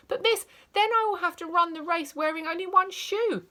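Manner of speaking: put-on child pleading with teacher. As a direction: protest like a wronged child. Child protests.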